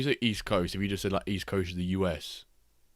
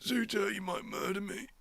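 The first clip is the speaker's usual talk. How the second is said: high mock fear; 14 semitones higher, 4 dB quieter than usual.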